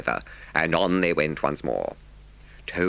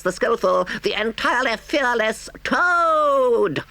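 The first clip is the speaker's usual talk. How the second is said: very high, crowing Toad; reckless boastful shout; 4 dB louder than usual. A narrator's reading. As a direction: proclaim yourself grandly, stretching the big words. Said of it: "he shouted recklessly".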